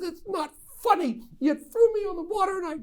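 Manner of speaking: put-on high whiny little-boy voice, wounded; upset crying child. Father imitates his son crying after being flipped off the tube, in a high whiny voice. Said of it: "he's crying".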